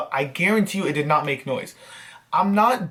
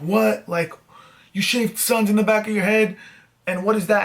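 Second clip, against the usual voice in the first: higher, incredulous parent voice; mock shocked parents; 5 semitones higher than usual. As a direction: play the stunned parents in disbelief. Shocked parents see shaved head.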